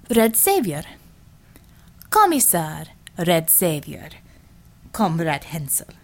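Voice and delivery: slow, firm Russian correction; stern Russian commissar voice; Russian accent. A narrator's reading. Natalia correcting firmly.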